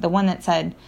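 usual speaking voice

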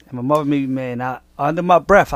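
ordinary delivery

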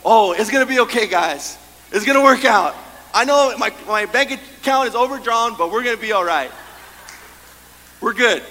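loud, high, hammed-up cheerful optimist; over-the-top optimist. Preacher mocking the overly positive, loud and chirpy. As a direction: gush like nothing could ever go wrong. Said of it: "people that are just over, like".

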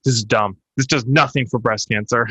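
usual speaking voice

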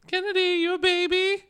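very high, loud pet-talk; shrieky coo at a beloved dog; gushing sing-song baby voice. Pretend.

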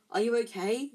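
urgent concern after the crash. A narrator's reading. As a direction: ask quickly, worried for your friend. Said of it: "said Harry urgently".

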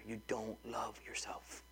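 slow, soft whispered point. Acted. Pastor whispering a hard truth to the church. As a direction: drop to a whisper to land it.

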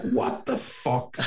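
high, drawn-out disbelief through laughter; incredulous retelling. Retold or imitated. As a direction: act out being gobsmacked, stretching it out. Retelling his shock at question.

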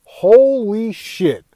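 a mild reenacted gasp of shock; awed disbelief. Retelling his shock at the death scene, slow and awed. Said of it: "I was like".

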